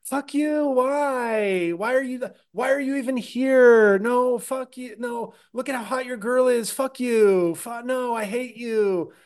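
Jo Koy yelling at crowd. Retold or imitated. Friend imitating Jo Koy scolding the crowd, playful high whine. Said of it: "he just kept impersonating Jo Koy, like, yelling at the crowd".

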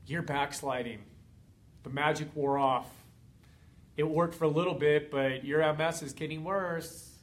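mock the listener like a smug doubt monster; taunting gorilla. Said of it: "freaking gorilla just hanging on you, being like".

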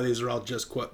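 ordinary delivery

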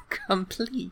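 complete said with a laugh in air quotes. Shown as a demonstration. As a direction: giggle through complete as a quoted word. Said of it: "Why do you say it like that?".

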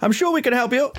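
put-on high, thin old gentleman's voice; old man through a door. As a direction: call out as an old man behind a closed door. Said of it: "I call through the door".

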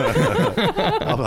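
high burst of laughter; laughing. Real, not acted. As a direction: laugh through it. Laughs at own joke.

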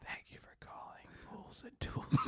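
whispered phone greeting; creepy whisper. Acted. Creep answering the Pools and Tools line, whisper. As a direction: breathe it like a sleazy hotline.

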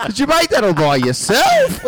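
high, loud, laughing preschool praise; condescending teacher voice. Retold or imitated. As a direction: patronise her like a small child.

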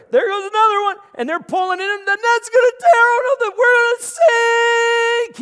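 high, loud panicked cries as the net tears; panicked shouting. Retold or imitated. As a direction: yell in panic like the boat's going down. Peter panicking as nets tear.

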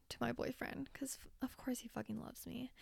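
a whispered aside to the listeners. Real, not acted. Telling listeners about her boyfriend in a whisper.

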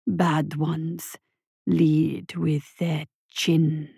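low, very slow rumble; deep slow rumble with an accent; rumbling voice. A narrator's reading. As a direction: rumble it like distant thunder. Creature advisor continues.

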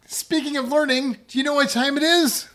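high, loud host intro, bigger; excited retake. Shown as a demonstration. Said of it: "Let's try that again".

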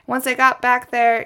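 ordinary talk, nothing marked

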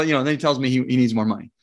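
usual speaking voice

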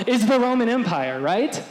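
ordinary talk

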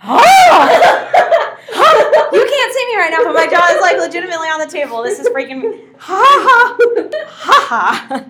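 laughing in disbelief, high and loud; shocked laughter. Real, not acted.

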